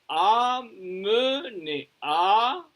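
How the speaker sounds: AMENER very slowly split as a, me, ner; syllable by syllable. Shown as a demonstration. Teacher showing the syllables, slow and separated. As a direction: break AMENER into three drawn-out syllables. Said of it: "Alors, une syllabe".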